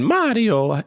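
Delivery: "Mario" lifted high in a cartoon Italian accent; a quick hammed-up Italian "Mario"; mock Italian accent. Making a point to a friend in a mock Italian accent. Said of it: "in the same accent".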